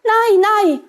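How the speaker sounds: high, loud cry of refusal; high-voiced goat children refusing; children calling out. A narrator's reading. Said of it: "haben sie gerufen".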